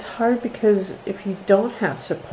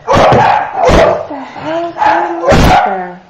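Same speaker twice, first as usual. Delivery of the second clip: loud and higher, dragged out slowly; startled loud outburst; 9 semitones higher, 11 dB louder, much slower than usual. Real, not acted. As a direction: shout over the barking dogs, surprised. Dogs suddenly bark at something.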